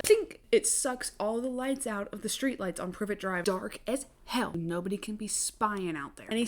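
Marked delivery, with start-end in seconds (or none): 0.0-0.4 high, sharp mouth click; lighter click noise. Retold or imitated. Imitating Dumbledore's lighter, a high sharp click. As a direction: pop the sound out like a gadget. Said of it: "and he goes like".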